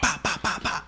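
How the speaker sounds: high, popping bah bah gunshot noises; mock gunfire. Buddy mimics the shootout scene for the podcast hosts, in popping gunshot noises. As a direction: spit out the shots like a kid playing guns. Imitating the Ben Foster gunshots.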